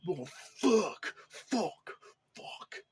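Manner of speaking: high, sloppy slurred "fuck" over and over, hammed up; mock slurred swearing. Reviewer mocking an annoying character's slurred swearing, hammed-up slur. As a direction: parody a bad actor mangling "fuck". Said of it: "this is the way he's saying it".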